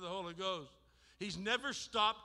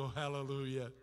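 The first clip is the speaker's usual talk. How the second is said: soft hallelujah; 6 semitones lower, 4 dB quieter than usual. Real, not acted. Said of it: "worship exclamation".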